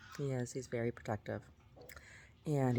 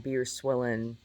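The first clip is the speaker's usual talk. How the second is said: ordinary delivery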